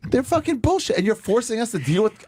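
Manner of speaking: high-pitched, worked-up griping; indignant rant. Real, not acted. Ranting to the host about airport screeners, indignant. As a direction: rail at them, pitched well up.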